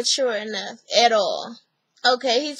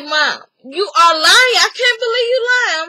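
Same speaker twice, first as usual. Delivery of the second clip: loud retold outrage, rising higher; acted accusation; 6 semitones higher, 7 dB louder than usual. Daughter accusing her mother of lying, acted indignation. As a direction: imitate an indignant daughter calling out a lie.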